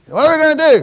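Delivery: mock panic. Mocking a panicked believer.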